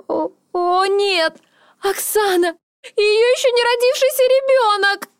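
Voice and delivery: высокие истерические рыдания; рыдай в истерике, на высоте; toddler tantrum. Acted. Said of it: "Истерика охватила меня". (истерические рыдания)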